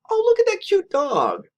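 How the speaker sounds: high, bright-eyed wife's voice; lightly imitating an eager wife. Wife on the couch points out a dog, eager high voice. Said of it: "She looks up from her book and says".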